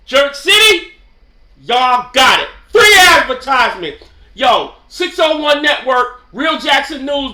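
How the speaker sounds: hyped loud shout-out. Real, not acted. Shouting out the Jamaican spot to viewers, loud hype. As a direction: call it out loud like a promo.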